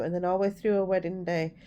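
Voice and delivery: normal talking voice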